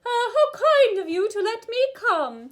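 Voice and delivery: very high, loud head-voice impression; sing-song, ringing head register demo. Shown as a demonstration. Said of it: "more of our [name] voice".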